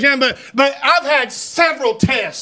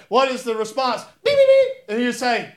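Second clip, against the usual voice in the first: ordinary talk, nothing marked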